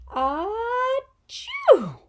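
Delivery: big hammed-up sneeze, high and loud; a showy pretend sneeze for the kids; mock sneeze. Shown as a demonstration. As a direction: act out a huge sneeze for the class. Shows what a pepper flake does.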